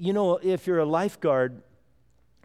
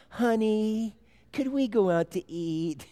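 high, honeyed wife asking a favour; sweet coaxing voice; 6 semitones higher than usual. Retold or imitated. Wife coaxes husband to take kids out, sweet voice. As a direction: coax the husband gently, sugar-sweet. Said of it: "She would have a certain sweet voice".

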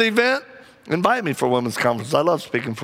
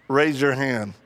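usual speaking voice

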